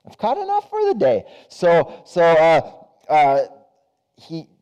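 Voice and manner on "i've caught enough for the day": much higher, singsong repeat of "I've caught enough for the day"; fisherman's answer repeated up high; high fisherman echo. Acted.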